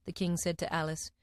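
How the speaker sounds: ordinary delivery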